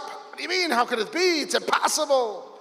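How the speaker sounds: sudden high-pitched protest; the Chassid in him jolts awake; indignant disbelief. Retold or imitated. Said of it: "the chassid in him wakes up".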